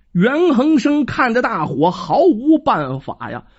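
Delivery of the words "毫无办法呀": plain reading voice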